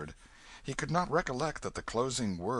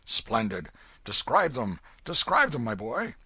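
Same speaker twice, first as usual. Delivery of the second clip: put-on eager old man; 3 semitones higher, 7 dB louder than usual.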